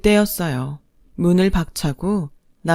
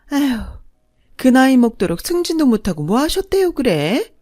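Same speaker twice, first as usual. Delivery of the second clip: higher, louder swooping sneer; haughty second mother-in-law, scornful; 4 semitones higher, 4 dB louder than usual. Acted. Second mother belittling the bride's parents, haughty and scornful. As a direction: look down on them with sing-song disdain.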